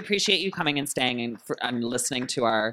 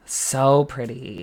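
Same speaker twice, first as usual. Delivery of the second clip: drawn-out so pretty; 6 dB louder, much slower than usual. Real, not acted. Echoing compliment.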